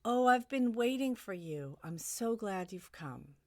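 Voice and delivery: glad greeting, starting high and loud, then settling; lifted, delighted welcome that eases down; welcoming friend. Acted. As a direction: greet a long-awaited visitor. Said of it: "And your dear friend answers".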